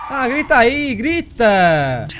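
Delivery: loud call, starting high and falling; goading shout. Real, not acted. Player telling the game character to scream, loudly goading. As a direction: egg them on at full voice.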